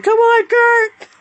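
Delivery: very high, loud and slow crowd yell; imitating crowd scream. Echoing the screaming crowd, a high drawn-out mock cheer. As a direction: scream it like a fan. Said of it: "You hear the crowd screaming".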